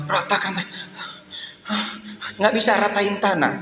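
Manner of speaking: high, puffed attempt at a rousing song; mock out of breath. Shown as a demonstration. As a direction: pant and give up on the song. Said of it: "napas ngos-ngosan". (pura-pura kehabisan napas saat bernyanyi)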